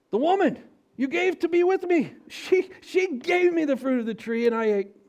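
high, loud blaming whine; man blaming the woman; whiny Adam. Acted. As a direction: pass the buck plaintively.

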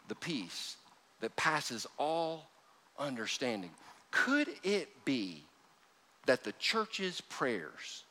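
soft, slow, wondering preacher voice; hushed sermon reflection. Acted. Preacher quietly wonders to the congregation, hushed and slow. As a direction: draw the room in with a low hush.

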